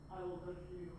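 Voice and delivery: low, soft husband voice; groom's wedding vows. Acted. [name] saying his vows on video, low and hushed. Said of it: "There's [name]. Stop it there".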